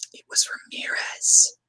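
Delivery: slow, whispered confession; whispered answer. Retold or imitated. Fan quoting Maroni's confession in a whisper. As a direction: breathe the name out.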